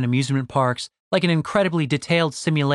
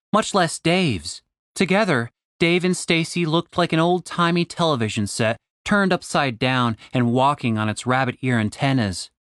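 ordinary delivery, nothing marked

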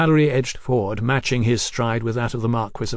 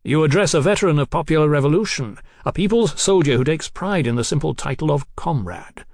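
higher, louder declaiming; proud revolutionary Marquis; 4 semitones higher, 4 dB louder than usual. Acted. Marquis correcting Mallory on his title, proudly declaiming. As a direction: announce it with affronted pride.

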